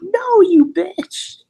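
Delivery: very high, drawn-out comic shriek; hammed-up indignant refusal, loud and slow; mock outraged yell. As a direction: yell it in pretend fury. Imagined angry deathbed reply, punching friend.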